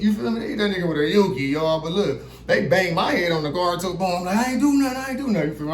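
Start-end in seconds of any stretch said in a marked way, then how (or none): 4.4-5.5 high pleading protest. Retold or imitated. Pleading with police while beaten: high voice. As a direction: beg them, voice up high. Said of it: "I'm pleading".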